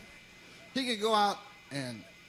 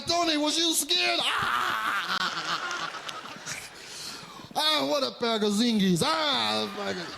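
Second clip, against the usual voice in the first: amused mobster; 3 semitones higher, slower than usual. Retold or imitated. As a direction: crow at the kid like a delighted mob boss. Mafia boss laughs at the kid.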